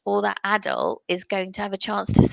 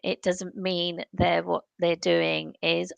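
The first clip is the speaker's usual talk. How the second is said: ordinary talk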